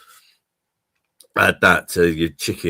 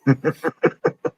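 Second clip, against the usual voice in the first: high laugh; 8 semitones higher than usual. Real, not acted. Laughing at own joke.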